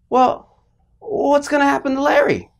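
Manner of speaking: high, anxious mock-child plea; shocked child. Child asking parents about his tree's fate, acted shocked worry. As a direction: plead like a worried little kid, high-pitched. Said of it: "I'm shocked".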